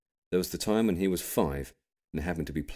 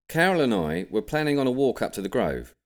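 higher, louder dad voice; Lindsay speaking; 8 semitones higher, 5 dB louder than usual. A narrator's reading. Dad telling Grampy the day's plan, higher and louder. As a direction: announce the plan a bit pompously. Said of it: "said Lindsay".

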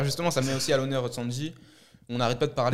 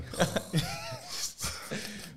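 high burst of laughter; 9 semitones higher, 6 dB quieter than usual. Real, not acted. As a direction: laugh out at the joke. (éclat de rire aigu)

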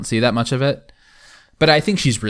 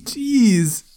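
Kip voice; 10 semitones higher than usual. Retold or imitated. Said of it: "Kip's just".

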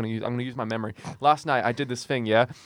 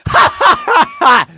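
much higher, louder, slow cackling ha ha ha; ridiculous laugh; 14 dB louder, much slower than usual. Retold or imitated. Mocking a coworker's ridiculous laugh, high, loud and slow. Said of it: "she has this ridiculous laugh".

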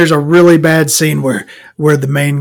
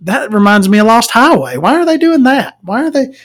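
exasperated inner voice; 7 semitones higher than usual. Retold or imitated. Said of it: "I'm like".

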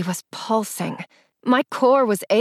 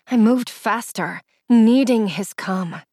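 usual speaking voice

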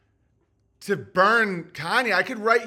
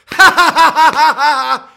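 big, high, rapid cackling burst; loud high laughter; 9 semitones higher, 16 dB louder than usual. Real, not acted. Comedian cracking up over the punchline, loud high laughter. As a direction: crack up loudly at your own joke. Cracks up at his own joke.

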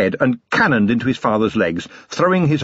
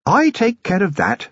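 lightly raised, refined lady's voice; a fond, well-bred wife; Lady Sybil, genteel lady; 10 semitones higher than usual. Acted. Wife cutting in on her husband, refined lady.

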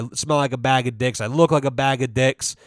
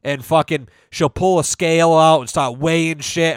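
normal talking voice

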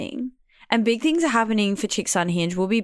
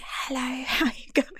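faint, higher mock of a badly recorded guest; bad-audio guest voice; 5 semitones higher, 5 dB quieter than usual. Podcaster mocking poor sound quality, faint distant voice. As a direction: imitate a barely audible guest, soft and high. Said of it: "our guest's voice is like".

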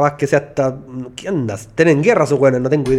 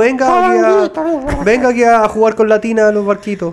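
put-on much higher, slow sing-song calling to a little kid; coaxing a child; 6 semitones higher than usual. Pretending to call little Covadonguito to the bath, high sing-song coaxing. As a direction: call him in sweetly to play with the boats.

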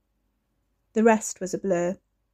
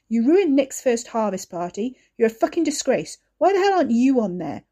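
loud, higher angry rant; furious tirade; 3 semitones higher, 5 dB louder than usual. Acted. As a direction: brother tearing into Ricky. Wes berates Ricky.

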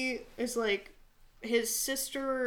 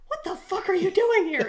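high, loud disbelief with a laugh; hammed-up shout of shock; mock startled yell; 9 semitones higher, 8 dB louder than usual. Soldier finds his friend hiding with beer, mock outraged yell.

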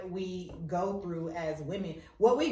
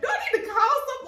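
laughing panic; 13 semitones higher, 4 dB louder, faster than usual. Retold or imitated. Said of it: "I be legit scared".

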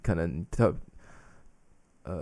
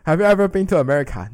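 1 curious foreigner; 7 semitones higher, 8 dB louder than usual. Retold or imitated. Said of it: "他们就会说".

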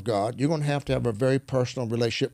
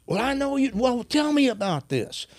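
quoted eager reply; 11 semitones higher than usual. Retold or imitated. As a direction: lift the voice high, curious and warm. Retold man's eager reply.

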